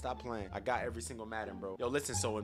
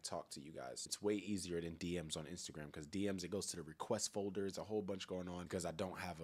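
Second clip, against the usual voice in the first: ordinary delivery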